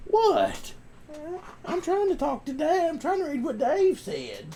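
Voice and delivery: very high put-on dog voice, annoyed. Owner voicing his dog interrupting chat reading, high put-on voice.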